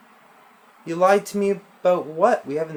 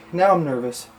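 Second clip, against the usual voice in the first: ordinary talk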